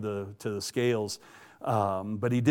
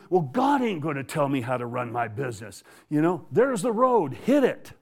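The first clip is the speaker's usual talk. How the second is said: acted angry dismissal. Boss throwing out the trucker, mock angry dismissal. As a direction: send him packing, voice rising sharply. Imitates boss firing him.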